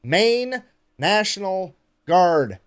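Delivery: very slow, each word of "Maine National Guard" separated; over-enunciate MAINE, NATIONAL, GUARD one at a time; careful retake. Real, not acted. Careful retake after flubbing the name.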